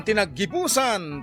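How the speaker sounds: ordinary talk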